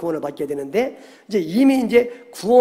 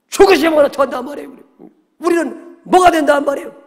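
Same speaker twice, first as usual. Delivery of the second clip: high, loud, indignant pleading; acted-out outburst of objection; loud protest; 9 semitones higher, 7 dB louder than usual. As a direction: argue back at the master, pitch raised. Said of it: "막 큰 소리로 항변했다는 얘기는".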